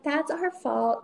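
a drawn-out, sheepish admission; slow mock apology. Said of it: "And she was like".

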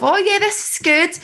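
mock pleased voice. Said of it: "It gives you a little bit of".

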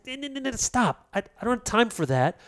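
God interrupting. Acted. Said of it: "And God is saying".

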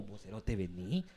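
low, soft, hushed call to coworkers; hushed calling over. Retold or imitated. Agent quietly calling coworkers to hear the screaming caller, hushed and low. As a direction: call your buddies over under your breath. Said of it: "llamar a toda la mara".

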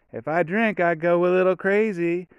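high-pitched imitation of a demure girl; demure church girl. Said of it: "like, a little church girl".

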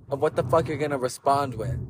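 ordinary delivery

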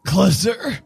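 high, put-on vampire voice; decrepit old vampire. Old vampire wants the object nearer, high decrepit voice. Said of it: "decrepit looking vampire with a walking stick".

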